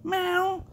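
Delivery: high squeaky meow imitation; cat meow. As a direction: mew like a little cat, way up high. Friend answers cat with meow.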